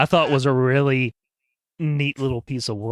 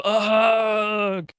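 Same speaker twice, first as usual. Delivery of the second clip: mock grunt of tripping; 10 semitones higher than usual. Imagined tripping over toon remnants.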